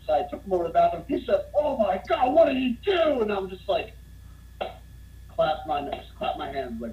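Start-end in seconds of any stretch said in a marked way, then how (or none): 1.6-3.2 high-pitched, appalled outburst; imitated horror. Owner seeing his wrecked bathroom, acted-out shocked dismay. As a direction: react aghast as the bar owner.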